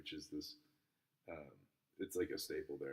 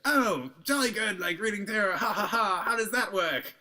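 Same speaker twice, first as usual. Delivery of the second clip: high mock posh British accent with a laugh; 9 dB louder than usual. Mocking a British tourist at the steakhouse in a high British accent. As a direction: ham up a plummy English toff, laughing. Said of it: "this whole pack of British people".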